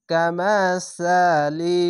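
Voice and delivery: কামাসালি drawn out slowly, syllable by syllable; slow recitation. Shown as a demonstration. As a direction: chant কামাসালি stretched. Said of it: "কাব জবর কা".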